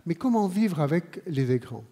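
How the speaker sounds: normal talking voice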